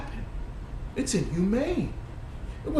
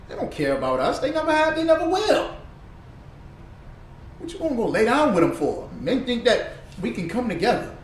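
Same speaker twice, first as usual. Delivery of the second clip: usual speaking voice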